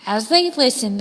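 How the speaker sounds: plain reading voice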